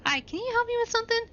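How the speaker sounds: sweet high-voiced request; high fast ask. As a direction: pipe it up in a girly rush. Player asks the proprietress.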